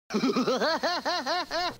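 long, high cackle; drawn-out high mock laugh; hammed-up laugh. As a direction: cackle after a big segment announcement. Follows the shouted segment title.

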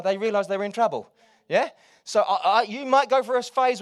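loud, high, frustrated preacher's cry; fiery preaching. Acted. Preacher voicing frustration to the church, loud and fiery. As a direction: rattle their cage with your voice.

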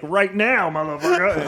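high mock thug challenge; tough guy threat. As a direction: bark it like a bar brawler. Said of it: "Those guys gonna kick my ass".

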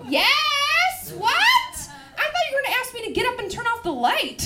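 high, loud yes, then a fast rush; acted eager outburst. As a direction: burst out in mock amazement. Acts overjoyed reply to boyfriend's request.

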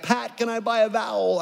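high-pitched, half-joking desperate plea. Retold or imitated. Last contestant begging Pat, mock desperate. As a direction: plead like a game show contestant running out of chances. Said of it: "it's, like, super, super desperate".

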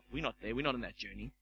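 low, soft mock indifference; unenthusiastic reply. Congregation answering an excited preacher, quietly unenthusiastic. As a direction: drop right down, unmoved by the excitement.

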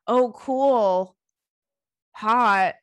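flat, mocking "oh cool, hot" reply; mock impressed. Mocking a dull gym guy's texts, sarcastic. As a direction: fake enthusiasm, teasing a dull date. Mocking a boring man's chat.

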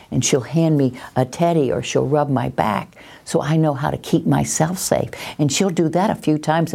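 normal talking voice

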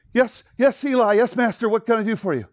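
high, loud, startled readiness; eager servant. Acted. Boy woken at night runs to his master, eagerly loud. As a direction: jump to serve your master. Samuel runs to serve Eli.

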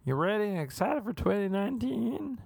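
high sing-song mock Swedish-American accent; hammed-up Swedish-American excitement; over-excited Uber driver. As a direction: pitch it way up, an eager Swedish-ish driver. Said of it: "sounded Swedish, but maybe American, too".

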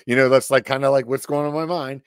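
ordinary delivery

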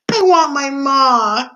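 high, loud, drawn-out wail; anguished girl's cry for her mother. A narrator's reading. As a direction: wail it slowly to the whole world. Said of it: "cried Mig into the silent".

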